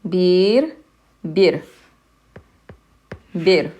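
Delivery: Bir with a clipped short i, as in bit; short vowel demo. Shown as a demonstration. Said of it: "we put a short vowel".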